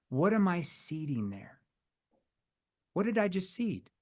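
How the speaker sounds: usual speaking voice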